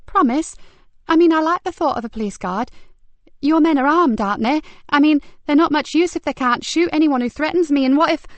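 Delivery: high, loud and anxious; agitated boy. Acted. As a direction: rush on in rising panic. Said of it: "The boy's agitation was becoming almost alarming".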